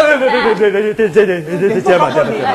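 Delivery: flustered stammer, repeating each word; stuttering. Acted. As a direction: stammer out agreement in a rush. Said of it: "一激动就结巴". (结结巴巴地应和)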